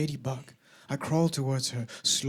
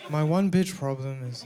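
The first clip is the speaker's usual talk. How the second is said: ordinary talk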